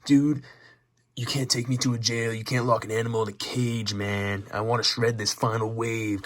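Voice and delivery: hammed-up Swayze surfer; surfer dude. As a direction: plead like a wild surfer refusing jail. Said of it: "Swayze's like".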